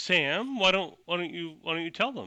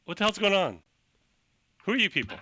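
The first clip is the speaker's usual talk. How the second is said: indignant official; 7 semitones higher, faster than usual. Acted. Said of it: "He says".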